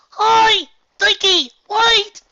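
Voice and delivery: very high, loud shout; calling out. A narrator's reading. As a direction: shout across the platform. Colt calls across crowd.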